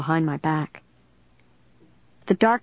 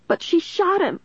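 high, distraught outcry; crying out; 13 semitones higher than usual. A narrator's reading. Caitlin accusing Joanna before police, high distraught cry. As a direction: cry it out, pitched way up. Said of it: "Caitlin cried".

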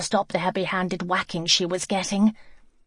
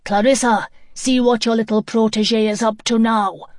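loud angry call across the yard; angry shout; 4 semitones higher, 6 dB louder than usual. Acted. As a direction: shout for her, fed up. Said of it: "Mister Bender shout angrily".

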